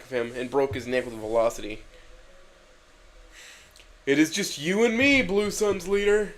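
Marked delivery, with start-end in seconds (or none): 4.1-6.3 intimidation. Acted. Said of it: "with intimidation".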